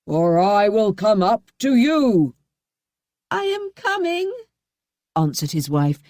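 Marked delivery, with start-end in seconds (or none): 0.1-2.3 very slow, drawn-out command; slow menacing shout. A narrator's reading. Bluebeard shouting up the stairs. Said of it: "shouted Bluebeard". 3.3-4.3 high begging voice; pleading wife. A narrator's reading. Wife begging Bluebeard for time.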